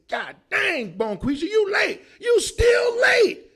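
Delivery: loud high mock yell; yelling boss. Comic playing a boss yelling at a late Bonquisha. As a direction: bark it like an angry boss. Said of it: "or he say".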